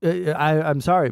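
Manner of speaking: usual speaking voice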